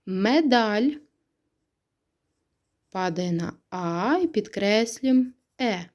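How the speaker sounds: ordinary delivery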